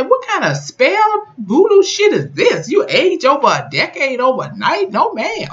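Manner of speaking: high, squealing outraged disbelief; shocked disbelief. Retold or imitated. Viewer reacting to Michael aging overnight, high shocked squeal. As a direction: shriek it like you can't believe what you just watched.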